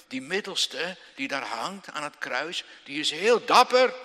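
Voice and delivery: loud, rising hellfire preaching voice; a preacher thundering about his hero; full-force preaching. Acted. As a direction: proclaim it to the whole church.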